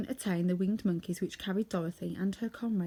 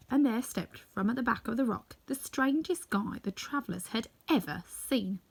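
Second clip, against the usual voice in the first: plain reading voice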